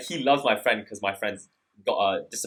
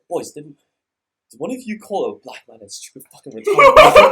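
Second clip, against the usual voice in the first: louder, mock-stern demand; stern coach; 3 semitones higher, 14 dB louder than usual. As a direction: bark it like an unhinged coach. Coach confronting the boys.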